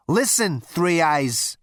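loud, cocky and impatient; brash Zaphod. Acted. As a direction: throw your weight around. Zaphod threatens.

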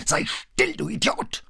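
high, strained outburst; yelling. A narrator's reading. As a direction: yell the insult in exasperation. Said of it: "schrie Ford sie an". (außer sich angeschrien)

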